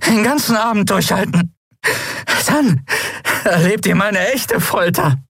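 high, halting, effortful voice; pained, high-pitched bravado in broken phrases; strained defiance. A narrator's reading. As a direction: taunt them between gasps of pain. Tortured singer defies her captors.

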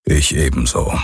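low-pitched, grumbled agreement; gruff grumble. A narrator's reading. Rider agrees with Galen's doubtful hope, grumbling. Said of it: "brummte Hox".

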